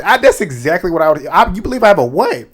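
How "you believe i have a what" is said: sassy disbelief. Retold or imitated. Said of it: "what I just did is what Ava did".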